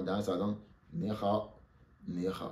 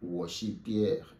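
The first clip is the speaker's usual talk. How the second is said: slow, deliberate; slow model phrase; much slower than usual. Shown as a demonstration. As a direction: model it slowly for the class. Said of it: "Si on renverse". (lentement, posément)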